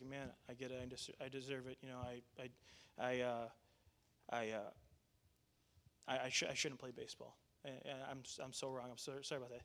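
very soft, low, halting apology; subdued, faltering admission of guilt; tearing up. Retold or imitated. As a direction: imitate a chastened boy owning up. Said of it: "he's tearing up".

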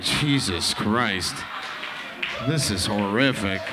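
muttering horror at a bad act; disgusted groan. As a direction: drag it out in disbelief. Disgusted reaction to bad comedian.

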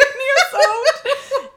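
very high, loud, slow, laughing. Acted. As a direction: stretch the name out, cracking up. Repeating the silly name laughing.

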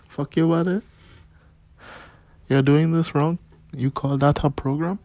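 high, loud mock Indian scolding; Indian accent. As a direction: tell them off in a hammed-up Indian accent. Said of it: "Imagine talking to yourself like that".

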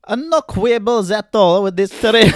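high, loud mock nonna; old nonna. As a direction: attempt an elderly Italian grandmother. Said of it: "Can you do an old nonna".

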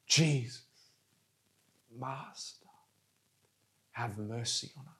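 low, very soft plea, phrase by phrase; almost whisper it, with long pauses; hushed prayer. Acted. Preacher modelling the prayer for the congregation, hushed and pleading.

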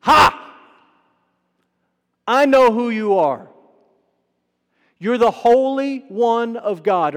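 loud, stretched-out demonic outburst; top-of-the-lungs blurt. Retold or imitated. Demon-possessed man disrupting Jesus's teaching in church, blurting out loudly. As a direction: burst out loud and high, then ease down. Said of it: "blurts out at the top of his lungs".

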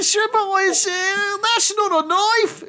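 hammed-up, very high Australian accent; bad Australian accent. Shown as a demonstration. Actor mocking Australians who can't emote in American, high bad Australian accent. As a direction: squeal it in a terrible mock Australian accent. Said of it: "Sorry about my Australian accent".

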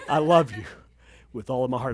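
low, soft acted sweet talk; real romantic. Preacher playing romantic husband to wife, real romantic. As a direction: ham up a hushed love vow. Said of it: "I mean, like, real romantic".

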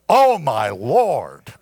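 OH punched, LORD drawn out and sinking low; slow, heavy, falling "Oh, my Lord"; emphatic. Acted. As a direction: stretch OH and LORD with a deep drop. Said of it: "pick up the emphasis".

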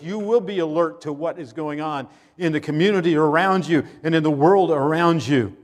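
usual speaking voice

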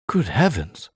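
high-swooping startled exclamation; narrated cry of shock. A narrator's reading. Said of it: "I cried".

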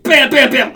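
loud, high rapid-fire bams; punch sound effects. Retold or imitated. Fighter getting his ear knocked off, shouted punch noises. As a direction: punch out each bam like a landed hit.

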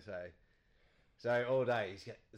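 ordinary talk, nothing marked

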